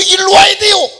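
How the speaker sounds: high loud call. Acted. Preacher calling out loudly. (높고 크게 부르는 소리)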